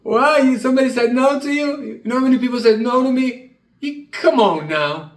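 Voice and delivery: high, loud incredulous friend; Troy incredulous. Retold or imitated. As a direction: snap him out of it with disbelief. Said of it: "He snapped me out of it".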